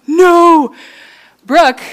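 loud, high drawn-out no; big playful wail of "no"; playful dismay. Real, not acted. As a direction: cry out in mock despair. Mock dismay at Brooke leaving.

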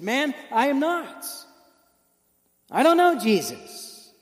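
slow, loud, insistent denial; emphatic denial. Acted. Peter insists to the bystander he is not one of them, slow and loud. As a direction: drag out every word of the denial.

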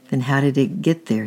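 plain reading voice

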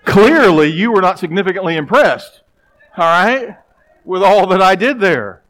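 loud, high, wounded protest; defensive outburst at his wife over his sermon; indignant retort. Retold or imitated. Indignant husband answering wife.

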